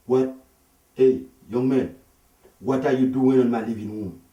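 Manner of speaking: loud, indignant demand from an African husband; angry husband. Retold or imitated. Husband confronting the young man in his living room, loud and indignant. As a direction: bark it at the stranger in your house.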